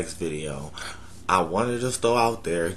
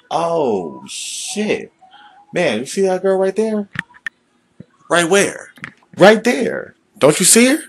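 much higher and louder, hyped; excited urge voice; 10 semitones higher, 6 dB louder than usual. Acted. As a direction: point her out, all worked up. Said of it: "a conversation with ourselves".

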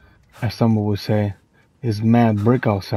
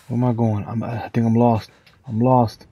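normal talking voice

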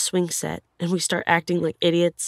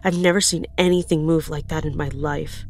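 ordinary delivery

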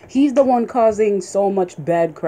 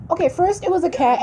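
normal talking voice

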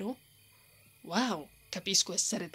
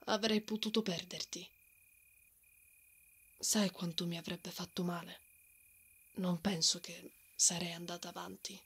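soft vulnerable confession; 3 semitones lower, 6 dB quieter than usual. Acted. Confessing fear of losing him.

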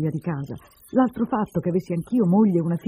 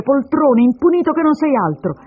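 loud, high, angry name-calling; hurled insults; 9 semitones higher, 11 dB louder than usual. Acted. Woman insults the man she calls her husband, furious. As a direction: hurl the names at him. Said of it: "a queste ingiurie".

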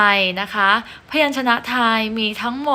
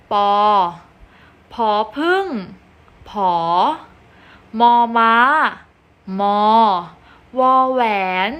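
choral reading lead. Shown as a demonstration. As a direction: lead the class reading together. Teacher leads choral reading.

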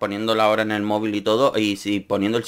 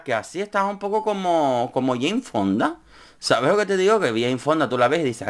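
ordinary talk, nothing marked

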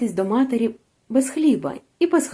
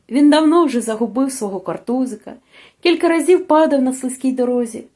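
plain reading voice

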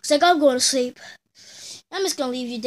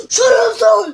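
much louder barked order; dad's yell; 6 semitones higher, 10 dB louder, slower than usual. Pretend. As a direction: shout him down like a fed-up dad. Dad yells at son.

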